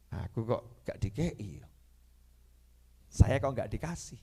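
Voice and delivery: soft, low and slow, to oneself; inner grumble. Retold or imitated. Guest privately wondering why he got none, muted. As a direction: think it quietly under your voice. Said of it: "Nah, saya batin".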